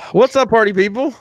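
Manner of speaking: loud, high party-host hello; hype host greeting. Acted. Host firing up his listeners, hyped. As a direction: pump up the crowd.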